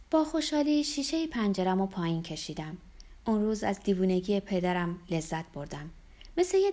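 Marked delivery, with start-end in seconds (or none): none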